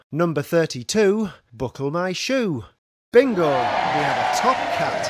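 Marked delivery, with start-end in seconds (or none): 3.1-5.1 shouting. Acted. Announcing a bingo win.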